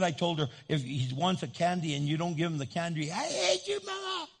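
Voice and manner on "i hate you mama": put-on very high whiny child's squeal; mock child tantrum, pitched way up; tantruming kid. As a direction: imitate a little kid throwing a fit. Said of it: "It's like a kid".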